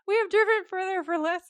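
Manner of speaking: high, loud exaggerated Sam impression; mock Sam voice. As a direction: ham up a pushy little brother. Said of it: "Sam's like".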